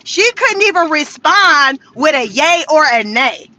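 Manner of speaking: usual speaking voice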